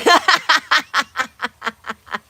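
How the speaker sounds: high, loud burst of laughing; real loud laughter. Real, not acted. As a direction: laugh hard, tapering into separate chuckles. Laughs at own joke.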